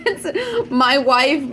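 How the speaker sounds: chuckling through the words; real laugh, slowed down. Real, not acted. Amused by the heard message.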